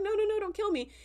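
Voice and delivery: pleading. Retold or imitated. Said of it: "he's like".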